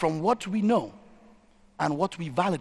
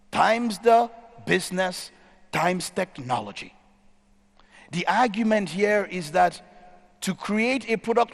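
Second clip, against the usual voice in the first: usual speaking voice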